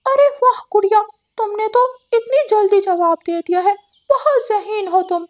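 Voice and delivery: a teacher thrilled by a quick answer; delighted teacher bird. Acted. Teacher praises Gudiya for fast answer, high delighted voice.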